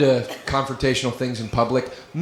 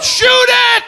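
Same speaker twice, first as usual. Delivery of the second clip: much higher, loud shouted order; 6 dB louder than usual. Pretend. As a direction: scream the order like a panicked cop. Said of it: "the authorities show up".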